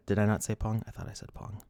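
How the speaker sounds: usual speaking voice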